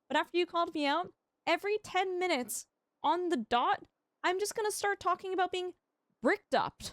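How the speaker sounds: indignant rant. Acted. Streamer ranting at a chatter who called him out, high and fast. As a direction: fire off the complaint at a chatter, playfully outraged.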